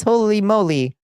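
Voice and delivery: amazed gasp of delight; surprised. Real, not acted. Reacting to a donation.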